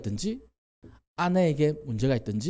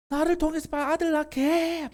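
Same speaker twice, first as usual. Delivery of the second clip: demanding wife. Retold or imitated. Rachel nagging her husband, high whiny demand. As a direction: nag like an impatient wife.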